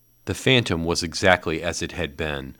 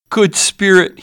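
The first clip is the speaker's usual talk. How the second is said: much higher, loud plea; begging cry; 11 dB louder than usual. A narrator's reading. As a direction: beg on the ground. Scrooge falls begging.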